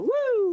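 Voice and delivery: loud high whoop; excited whoop. Real, not acted. Cheering after joke.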